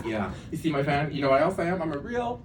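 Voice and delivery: ordinary talk, nothing marked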